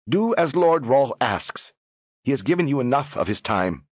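commanding noblewoman. Acted. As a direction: speak down to the ambassadors. Catherine voiced, higher.

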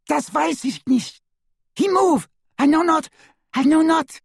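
very high, loud frantic pleading; terrified cries. A narrator's reading. Woman at wandpoint insisting she knows nothing, high panicked cries. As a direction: cry out in mounting panic.

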